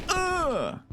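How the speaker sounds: sharp high strained grunt; high pained grunt. Real, not acted. Gamer grunting as the boss lands a hit, high strain. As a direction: grunt like you've been struck.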